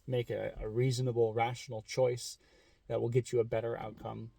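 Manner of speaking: ordinary talk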